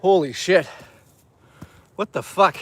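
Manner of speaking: ordinary delivery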